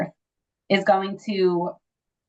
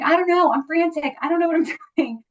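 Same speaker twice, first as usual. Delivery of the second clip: frantic husband; 9 semitones higher than usual. Retold or imitated. Husband says he's frantic.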